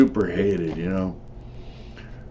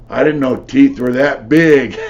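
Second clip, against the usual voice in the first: higher, louder wide-eyed wonder; amazed; 5 semitones higher, 7 dB louder than usual. Retold or imitated. Kid holding the knocked-out tooth, amazed.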